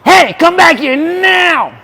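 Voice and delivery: loud slow call after someone; calling from far away. Real, not acted. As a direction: shout it across a distance. Friend ran off, calling after.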